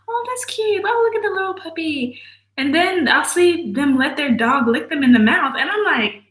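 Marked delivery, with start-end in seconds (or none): 0.1-2.2 much higher cooing over a puppy; mock cooing. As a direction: coo like at a baby animal. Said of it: "where I'm like".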